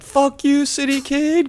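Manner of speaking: high-pitched mock jeer; Sticks. Farm boy jeering at the city kid, mock high voice. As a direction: taunt in a put-on high voice. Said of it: "Sticks is like".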